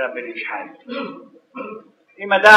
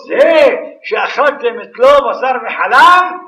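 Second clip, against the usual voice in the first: angels protesting; 6 semitones higher, 7 dB louder, slower than usual. Retold or imitated. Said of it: "אמר המלאכים".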